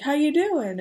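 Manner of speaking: bright high imitated hello; doctor greeting his patient, cheerful and high. Doctor greeting patient before test, imitated cheerful lift. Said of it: "he was really cheerful".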